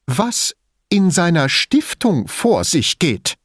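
slow, high, with "Stiftung" scornfully punched; mocking stress on Stiftung. A narrator's reading. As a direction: lean on "Stiftung" with open mockery. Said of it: "mit spöttischer Betonung auf dem Wort Stiftung".

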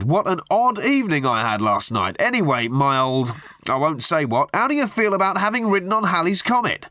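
butter him up with absurd pet names; gushing hack journalist. A narrator's reading. Tabloid journalist gushing down the phone at Arthur. Murray's voice again.

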